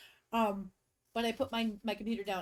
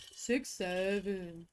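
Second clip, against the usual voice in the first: drawn-out, deadpan six seven meme; slow deadpan meme chant; slow meme drawl; 2 semitones lower, much slower than usual. Pretend. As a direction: stretch it out slowly like the meme.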